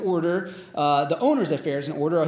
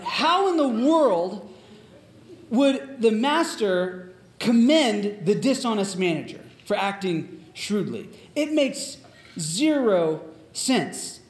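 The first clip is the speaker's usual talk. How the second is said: baffled disbelief; 2 semitones higher, slower than usual. Retold or imitated. Preacher questioning the master's praise, incredulous. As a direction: draw it out, utterly baffled by the logic.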